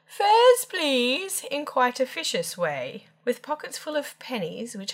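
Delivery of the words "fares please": high, loud conductor's call. A narrator's reading. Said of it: "calling out".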